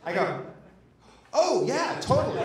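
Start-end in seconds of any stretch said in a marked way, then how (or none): 1.3-2.4 high, loud, laughing yes; blithe confirmation. Retold or imitated. Telling a scared friend it is haunted, blithe. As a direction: blurt it out with comic glee.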